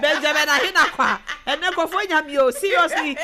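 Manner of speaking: high and loud through laughter; laughing. Real, not acted. Laughing hard while reading comments on stream.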